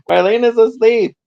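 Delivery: high, loud hammed-up protest; mock yelling. Yelling at Don about sleeping Marlena, mock yelling. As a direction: imitate someone yelling that defeats its purpose. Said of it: "Then why are you yelling".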